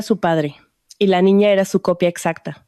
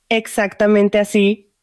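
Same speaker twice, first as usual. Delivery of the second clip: slow words with a laugh in them; slower than usual. A narrator's reading. Sofía answers her startled friend, laughing. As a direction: laugh it out slowly, amused. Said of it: "rió Sofía".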